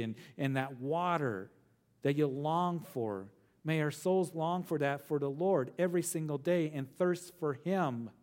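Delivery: normal talking voice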